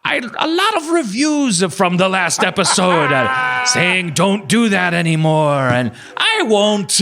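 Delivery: high, cheery host greeting, slowing at the end; playful imitation of a host answering his reviews; Norwegian podcast host impression. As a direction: ham up the apologetic Norwegian host. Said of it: "he was like".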